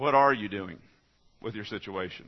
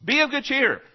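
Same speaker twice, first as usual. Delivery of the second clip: be of good cheer, much higher and louder, as an order; commanding; 12 semitones higher, 5 dB louder than usual. Shown as a demonstration. Preacher ordering his congregation, commanding and loud. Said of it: "It's a commandment".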